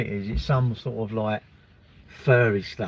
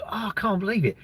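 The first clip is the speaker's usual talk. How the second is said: mock amazement; 7 semitones higher, 3 dB quieter, faster than usual. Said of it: "And you'll go".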